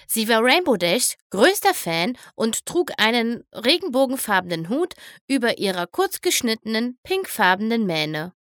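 usual speaking voice

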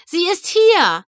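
high, loud urgent call. A narrator's reading. Another friend calls to Rainbow, high and urgent. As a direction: shout the warning across. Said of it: "rief eine andere Stimme".

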